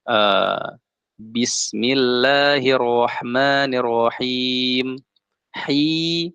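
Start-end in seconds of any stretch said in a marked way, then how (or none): 1.2-4.9 Bismillahirrahmanirrahim recited very slowly, vowels stretched; slow recitation. Shown as a demonstration. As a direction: chant it slowly like Quran recitation. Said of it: "Contoh misalnya yang panjang". (dibaca pelan dengan mad panjang)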